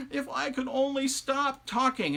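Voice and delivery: high, slow, desperate wail; worn-out wailing wish; ragged, pleading wife. A narrator's reading. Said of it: "her voice was so ragged".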